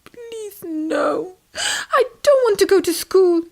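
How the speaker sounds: high, tearful begging; little tadpole crying and pleading; sobbing plea. Acted. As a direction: beg your mum through tears. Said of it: "heard little Tim's sobs".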